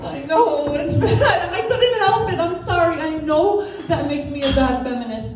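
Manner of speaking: high, pleading confession; mock-guilty apology. Comic confesses to the audience, mock apologetic.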